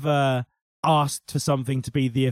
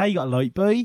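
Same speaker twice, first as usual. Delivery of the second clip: Norfolk accent; 4 semitones higher, faster than usual. Shown as a demonstration. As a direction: do the old Norfolk farmer.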